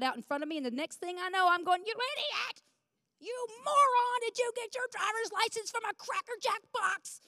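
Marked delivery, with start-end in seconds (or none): 1.9-7.2 high, mock-furious insults at a driver; hammed-up road-rage outburst; retold road rage. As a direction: yell at the guy who cut you off, half in fun. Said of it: "then I'm starting to scream".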